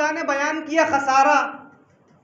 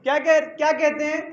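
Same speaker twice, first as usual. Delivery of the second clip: ordinary talk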